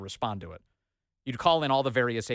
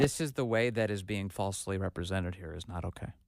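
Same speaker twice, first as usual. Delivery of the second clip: lower, softer mocking echo of the press secretary; mocking imitation; 6 semitones lower, 5 dB quieter than usual. Host mocking the press secretary's clumsy sentence, lowered voice. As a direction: parrot her words back, lower and softer.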